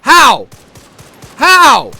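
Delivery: very high, very loud scream; raging scream. Real, not acted. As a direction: scream at the screen in fury. Screamed in rage.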